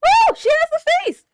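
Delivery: high, loud excited squeal; excited shriek. Real, not acted. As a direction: shriek it out in shock and thrill. Reacting to anime reveal.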